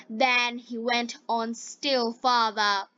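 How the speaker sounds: usual speaking voice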